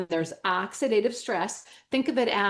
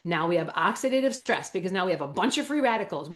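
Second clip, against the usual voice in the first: usual speaking voice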